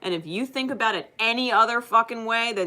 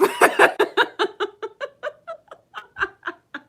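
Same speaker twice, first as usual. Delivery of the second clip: long, much higher laugh; long real laugh; 7 dB louder than usual. Real, not acted. Laughing after retelling her defiance. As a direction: let a long giggle roll out.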